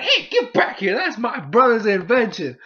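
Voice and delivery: loud, high indignant cry; Bonnie. Acted. Sister chasing her brother's stolen invention, loud indignant cry. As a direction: call after the thief in a high, loud voice. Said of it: "you just see Bonnie".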